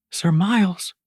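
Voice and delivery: high, loud call for help; crying out. Acted. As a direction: call across the crowd. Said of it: "he cried".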